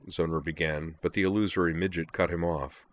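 plain reading voice

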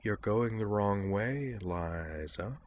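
soft, slow, menacing voice; silky menace. Acted. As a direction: speak softly with a quiet threat. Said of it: "in a soft, menacing voice".

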